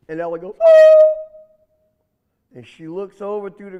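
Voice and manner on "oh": high loud yelp of surprise; startled cry. Acted. Little rabbit startled by the alligator, high yelp. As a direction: jump with fright.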